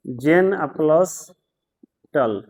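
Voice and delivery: "जेन", then a clear pause before "टल"; split into syllables. Shown as a demonstration. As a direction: sound out "जेन plus टल" slowly, one syllable at a time. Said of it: "दो, दो syllable है".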